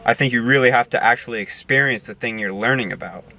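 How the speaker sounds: ordinary talk, nothing marked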